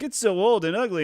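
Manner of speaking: high, sneering mock quote. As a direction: sneer at the old game. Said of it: "People make fun of EverQuest".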